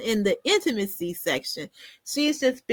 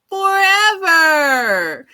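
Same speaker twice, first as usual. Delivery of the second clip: high, loud, drawn-out wail of doom; mock wail; 7 semitones higher, 7 dB louder, much slower than usual. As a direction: stretch it into a long, hammed-up cry, falling away at the end. Mock horror at being stuck eternally.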